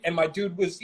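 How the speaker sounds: ordinary talk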